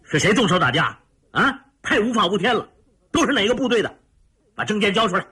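high, sharp officer's reprimand; stern questioning, pitched high; stern demand. A narrator's reading. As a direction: demand answers as the officer in charge. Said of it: "厉声问道".

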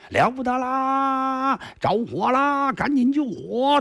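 high, drawn-out alarm cry; calling fire. A narrator's reading. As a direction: raise the alarm across the manor. Household crying fire alarm. (庄里人惊慌高喊救火)

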